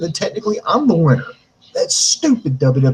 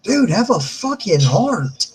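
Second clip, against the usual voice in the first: exasperated plea; 5 semitones higher, slower than usual. Acted. Acted plea to wrestler character.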